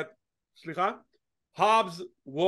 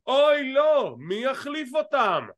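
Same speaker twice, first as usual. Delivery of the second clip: loud, high, hammed-up dismay; exaggerated storyline panic; mock alarm; 6 semitones higher, 4 dB louder, slower than usual. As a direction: play a melodramatic cliffhanger, drawing out the question.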